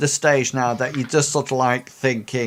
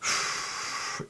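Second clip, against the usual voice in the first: slow, voiceless blowing out of breath; long calming exhale; 12 dB quieter than usual. Acted. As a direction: breathe out to settle the nerves. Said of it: "you sort of, like, go".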